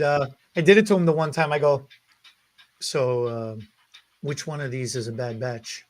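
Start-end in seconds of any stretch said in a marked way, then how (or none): 2.8-5.8 low, soft retold question; low-key question. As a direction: drop it low and quiet. Retells his sly question to Chuck.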